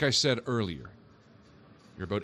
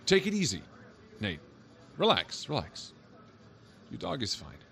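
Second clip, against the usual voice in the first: soothing; 6 semitones higher than usual. Acted. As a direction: talk him down, easing off on the last relax. Officer calming a panicking man.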